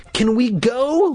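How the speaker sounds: high, drawn-out whine; demanding child. A narrator's reading. Boy ignoring Dad's request, demanding. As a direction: demand it slowly, pitched high. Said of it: "Luke demanded".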